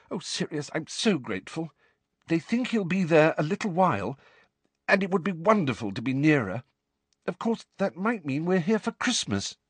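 a hint of a thankful mum; lightly voiced grateful mother. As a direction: thank him with relief. Mrs Weasley voiced.

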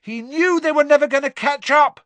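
very high, loud call back; shout over noise. A narrator's reading. Harry answering his friend over the noise, shouting. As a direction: shout your answer over a roaring crowd. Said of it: "Harry shouted back over all the noise".

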